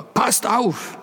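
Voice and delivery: high, urgent warning call; the father calls out suddenly, pitched well up. A narrator's reading. Father warns the boys about the spotlights, high urgent call. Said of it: "sagte Vater".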